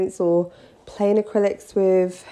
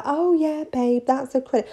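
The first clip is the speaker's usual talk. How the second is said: light, higher, casual reassurance; breezy nail tech; 6 semitones higher than usual. Retold or imitated. Nail tech answering a client, lightly raised and breezy. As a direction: toss it off sweetly. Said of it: "I'm like".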